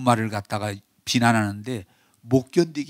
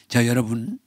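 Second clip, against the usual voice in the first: ordinary delivery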